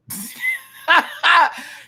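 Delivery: loud, high cackle; laugh. Real, not acted. Reviewer cracking up at the camera work, high cackle. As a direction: burst out in ringing laughter.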